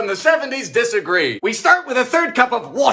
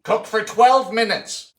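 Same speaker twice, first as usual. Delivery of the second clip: ordinary talk, nothing marked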